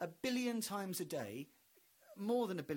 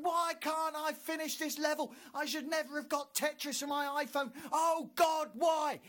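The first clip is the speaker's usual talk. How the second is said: high, loud frustrated wail; frustrated gamer yell; 12 semitones higher, 7 dB louder than usual. Retold or imitated. Bloke yelling at God over Tetris. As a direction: rage at the phone game. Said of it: "some bloke going".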